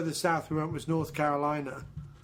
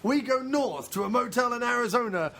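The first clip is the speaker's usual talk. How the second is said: big announcer voice easing back to normal; 3 semitones higher than usual. Pretend. Pitching an invented face-touching show, as a mock TV announcer.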